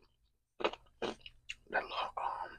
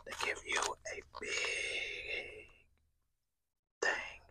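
whispering; 6 dB louder than usual. Real, not acted. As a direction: whisper close to the mic while eating. ASMR mukbang whispered throughout.

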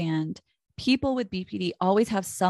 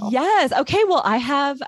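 excited; 6 semitones higher, 7 dB louder than usual. Real, not acted. Host reacts to the guest.

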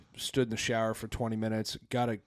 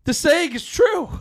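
loud, high, slow, laughing through it; laughing shout; 9 dB louder, slower than usual. Retold or imitated. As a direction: crack up as you shout it. Laughing quote of own reaction.

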